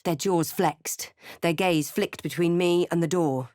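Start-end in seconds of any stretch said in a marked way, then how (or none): none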